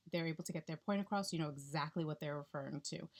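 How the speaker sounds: normal talking voice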